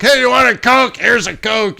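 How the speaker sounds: high, loud hostess offering a Coke; mock yell. Texan attendant tossing cans, imitated high and loud. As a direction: bellow the offer across the cabin. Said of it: "basically yelling at me".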